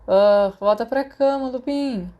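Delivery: a loud, slow groggy complaint; sleepy annoyed yell. Acted. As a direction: shout him back to bed, half asleep. Sirius annoyed, woken up. (grito irritado e sonolento, alto e arrastado)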